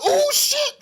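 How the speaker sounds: high-pitched shocked yell, re-enacted; retold yell. Viewer reacting to Eve's leg getting snapped, yelling. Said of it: "Like, I yelled".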